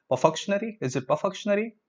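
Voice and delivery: "perfunctory" as per-FUK-shun-ary, questioning; questioned mispronunciation. Shown as a demonstration. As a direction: ask with "perfunctory" wrongly ending in -shun-ary. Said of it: "No, let's look at the syllable breakup".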